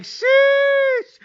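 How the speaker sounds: sudden high shouted "Sheesh"; sharp squealing shout of disbelief. Retold or imitated. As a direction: yelp it out in shock at the score. Reacting to 28-nothing score.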